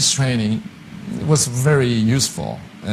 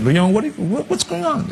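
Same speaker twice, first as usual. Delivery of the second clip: concerned teacher voice; 11 semitones higher than usual. Retold or imitated. Speech teacher voiced asking.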